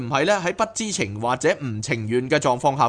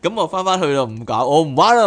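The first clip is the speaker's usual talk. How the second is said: laughing, louder mock complaint to the boss; mock fed-up quitting; 4 semitones higher, 4 dB louder than usual. Soul telling the boss it quits, mock fed-up. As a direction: whine jokingly that you give up.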